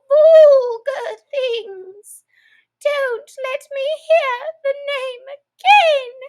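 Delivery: very high, loud, slow quavering shriek; trembling furious mouse. A narrator's reading. The trembling mouse rants at Alice about cats. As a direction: shriek and quiver with rage. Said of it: "trembling down to the end of his tail".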